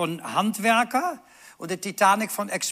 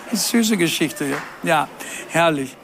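ordinary delivery

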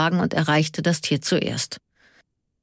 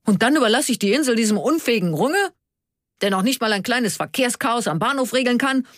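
higher, quick outraged question; indignant protest; 7 semitones higher than usual. A narrator's reading. Karl refuses to leave the island to Runge, indignant. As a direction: protest in raised pitch, scandalised.

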